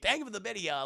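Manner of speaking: ordinary talk, nothing marked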